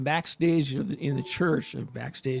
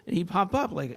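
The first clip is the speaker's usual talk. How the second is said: ordinary delivery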